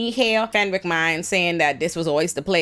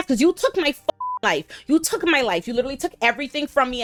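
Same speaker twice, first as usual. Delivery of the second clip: acted accusation; 7 semitones higher than usual. Louis arguing with Lestat.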